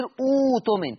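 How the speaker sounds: "أوتمن" opening on a long oo; hamza turned to waw. Shown as a demonstration. Teacher starting from the word itself, "أوتمن" with long oo. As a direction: start "أوتمن" with a held oo, no second stop. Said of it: "أبدلها واو".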